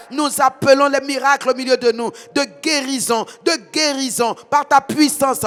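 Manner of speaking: ordinary delivery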